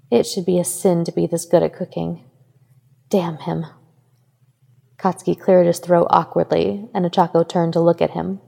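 ordinary delivery, nothing marked

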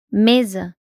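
ordinary delivery

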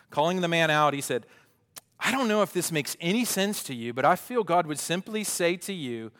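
loud, animated story reading; reading aloud. Acted. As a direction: read it out with punchy emphasis. Reads book passage aloud.

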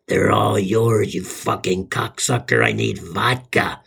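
low, slow possessed rasp; raspy exorcist voice. Retold or imitated. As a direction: growl it like a demon-possessed wife. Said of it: "in that Linda Blair exorcist raspy voice says".

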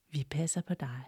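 soft whisper; hushed farewell from the tree. A narrator's reading. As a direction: breathe the promise. Tree's parting words.

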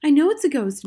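high, loud ghost warning; girl's dramatic, high insistence; feigned alarm. A narrator's reading.

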